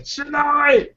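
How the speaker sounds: very high drawn-out cry of the name; high mock call. Game master echoes the yell to Shania, high mock call. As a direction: call out high without real shouting.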